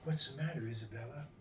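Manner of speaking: very soft, tender question; hushed and caring; gentle concern. Acted. Suitor gently asks crying bride.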